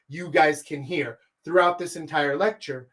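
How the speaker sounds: normal talking voice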